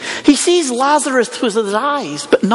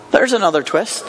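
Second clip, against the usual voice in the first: usual speaking voice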